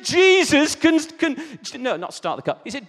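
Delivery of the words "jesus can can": high, loud relayed jeer; imitated scornful yell; mocking shout. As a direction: shout it to your mate in disbelief. Retold shouted mocking report to mate.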